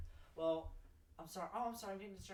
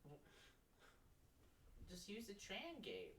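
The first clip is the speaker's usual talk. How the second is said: ordinary delivery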